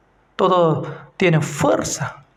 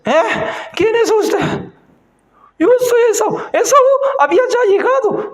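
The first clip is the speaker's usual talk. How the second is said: put-on startled old father; 11 semitones higher than usual. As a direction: cry out in shock at the deception. Blind Isaac questions son.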